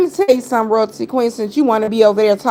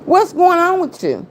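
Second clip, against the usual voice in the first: pitched high, taunting; high mocking repeat; 7 semitones higher than usual. Real, not acted. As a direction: sing it up high, teasing. Repeated much higher.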